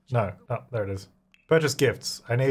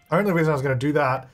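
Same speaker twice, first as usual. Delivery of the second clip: ordinary talk, nothing marked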